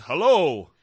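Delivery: booming mock hello, very loud; exaggerated loud call. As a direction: blast it out in exaggerated imitation. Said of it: "it wasn't quite that loud. It wasn't".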